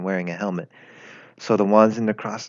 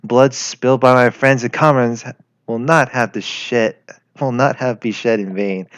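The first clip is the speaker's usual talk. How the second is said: patriotic speech; 4 dB louder than usual. Acted. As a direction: declaim it like a wartime address.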